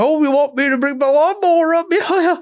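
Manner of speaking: hammed-up Maine local offering to bring the lawnmower; Maine accent. Dad chatting with the lawnmower repair guy, in a thick Maine accent. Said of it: "My dad had a Maine accent".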